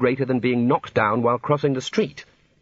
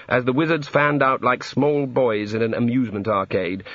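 plain reading voice

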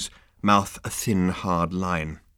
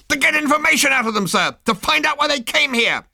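very high, fast, louder squeal; frantic subordinate squealing at his captain; squealing officer; 7 dB louder than usual. Acted.

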